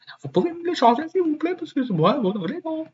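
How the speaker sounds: squeaky high, fast put-on voice begging; mock plea. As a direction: play a pathetic little voice pleading for French. Mock plea to change language.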